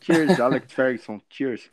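slow, deliberate English cheers; slow English toast. Pretend. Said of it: "Manda um salve pra ele em inglês aí". (brinde lento em inglês)

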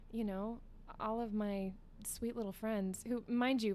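ordinary talk

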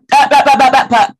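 loud, rapid-fire blurting, pitched high; rude interrupter. Retold or imitated. Imitating a guy who talks over people, rude and loud. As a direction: barge in with loud mock babble. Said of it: "people that do it very rudely".

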